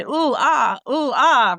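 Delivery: high, put-on ooh and ah moaning; hammed-up faked moans of pleasure; fake pleasure moans. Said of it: "She said".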